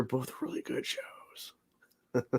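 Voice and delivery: slow hushed whisper. Real, not acted. Answering a fan's question in a slow whisper. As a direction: breathe it out, no voice.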